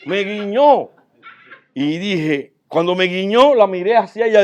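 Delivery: almost shouting with wonder; excited. Real, not acted. Amazed retelling of the wink.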